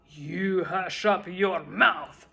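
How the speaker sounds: slow, loud howl. A narrator's reading. King howling at Mac to hush. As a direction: howl him down. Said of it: "howled the mighty King Yertle".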